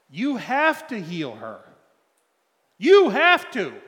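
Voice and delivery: loud, slow, escalating demand; pleading tirade rising into a yell. Retold or imitated. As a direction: build to a near-shout at God. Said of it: "I went through my tirade".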